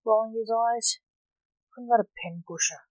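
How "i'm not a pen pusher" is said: ordinary delivery, nothing marked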